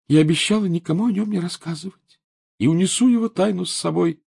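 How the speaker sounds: put-on high, whiny ghost-girl voice; reproachful little ghost; eerie ghost child. A narrator's reading. Ghost girl Myrtle speaks.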